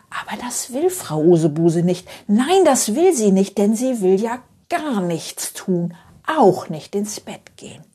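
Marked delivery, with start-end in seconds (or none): none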